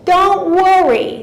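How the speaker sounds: lightly voiced Yiddish accent, high and slow; a hint of an old Yiddish father reassuring; mild Yiddish-accented don't worry. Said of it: "That was a Yiddish accent".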